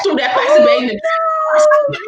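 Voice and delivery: high loud frantic telling. Real, not acted. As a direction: rush it out in panic. Panic climax of story.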